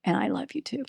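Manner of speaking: hushed and tender, barely there; hushed retelling of a son's last words; soft. Retold or imitated. Dying son's last words retold.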